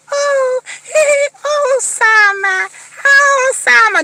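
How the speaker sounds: high, sing-song sugary greeting; imitating sweet Debbie. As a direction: coo his name sweetly. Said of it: "Debbie was all like".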